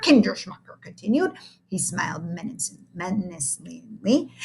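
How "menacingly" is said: plain reading voice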